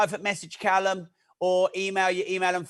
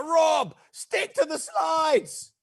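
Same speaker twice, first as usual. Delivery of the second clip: higher, shouty mock scolding from a colleague; mock telling-off; 7 semitones higher than usual. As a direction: ham up a boss yelling at Rob. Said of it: "Callum will be like".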